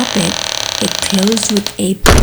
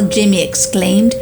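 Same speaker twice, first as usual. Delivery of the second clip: ordinary delivery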